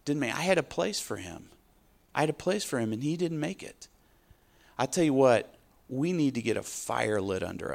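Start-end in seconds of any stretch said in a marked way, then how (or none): 0.0-3.9 a touch of heartbroken God, softer; God sorrowing. Retold or imitated. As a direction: let the grief sit quietly. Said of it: "God's saying".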